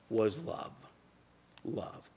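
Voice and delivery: quiet reverent emphasis on love; hushed preaching. Acted. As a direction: let love land in a near hush. Preaching soft climax.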